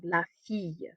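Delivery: "la fille" with the double L as a Y; Y sound. Shown as a demonstration. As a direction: end "fille" on a Y glide, not an L. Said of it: "gives us a Y sound".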